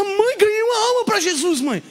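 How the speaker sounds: high, loud, bursting with news; excited kid calling his mother. Pretend. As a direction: blurt it out to mom. Child telling mother excitedly.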